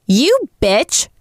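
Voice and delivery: high furious outburst; angry shout. Acted. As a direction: spit the insult in rage. Enraged woman insults narrator.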